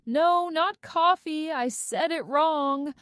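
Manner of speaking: inner scream. Acted. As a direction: cry it out in your own head, slowed. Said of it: "I scream".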